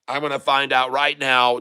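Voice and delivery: usual speaking voice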